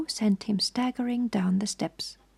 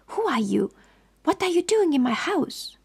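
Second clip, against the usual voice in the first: alarmed demand; 8 semitones higher than usual. A narrator's reading. Woman demands of intruders.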